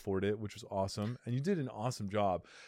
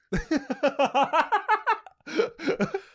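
very high squealing laugh; high burst of laughter; 7 dB louder than usual. Real, not acted. Laughing at raw dog joke.

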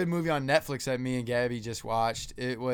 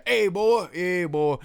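raised, punchy country greeting; country dude voice; 6 semitones higher, 6 dB louder, much slower than usual. Pretend. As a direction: holler it as a mock country boy. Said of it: "a whole podcast like that".

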